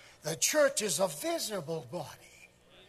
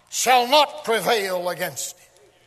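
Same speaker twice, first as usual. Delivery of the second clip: emphatic declaration; 7 dB louder, slower than usual. Real, not acted. Drives the verse home.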